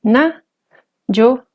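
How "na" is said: "Na" pitched high. Shown as a demonstration. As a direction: say "Na" lifting to a high tone. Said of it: "Todas estas palabras tienen tono alto".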